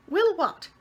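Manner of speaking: brisk and hearty; landlady answering the boy heartily. A narrator's reading. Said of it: "said Miss Persimmon heartily".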